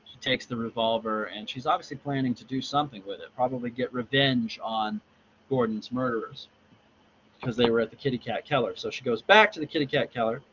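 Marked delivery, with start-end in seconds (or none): none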